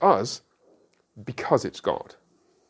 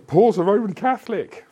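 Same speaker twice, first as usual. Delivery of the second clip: higher and louder, hammed-up scandalised cry; mock outrage; 11 semitones higher, 7 dB louder than usual. Pastor voices a scandalised student, higher and louder mock outrage. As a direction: play the shocked listener, over the top.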